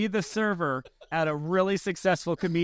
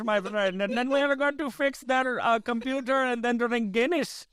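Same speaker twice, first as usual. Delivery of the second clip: mock Indian-Irish accent, mostly pitched up; 7 semitones higher than usual. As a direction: keep up the badly faked Irish that sounds Indian.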